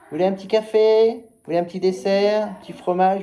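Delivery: high, loud, fawning waiter offering dishes; mock waiter voice. Host mimicking himself serving guests at table, high mock waiter voice.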